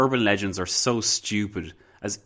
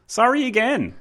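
much higher, louder sing-song sorry; playful apology; 7 dB louder than usual. Real, not acted. Host apologizing to listeners, bright squeal. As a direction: chirp the apology.

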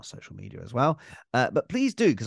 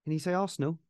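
singsong puppet show host; 5 semitones higher, much faster than usual. Real, not acted. Coaxing baby to talk.

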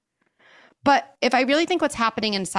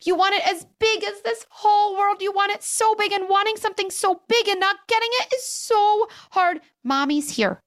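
put-on higher, singsong voice for a toddler; gently widen the words for a baby; talking to a one-year-old; 9 semitones higher than usual. Shown as a demonstration. Mother soothing her one-year-old, high gentle toddler voice. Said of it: "for a one-year-old open".

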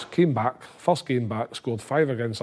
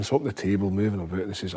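ordinary delivery

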